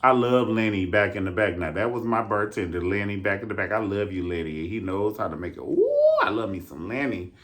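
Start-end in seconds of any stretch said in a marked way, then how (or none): 5.6-6.2 very high excited ooh; delighted squeal. Acted. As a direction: squeal with glee.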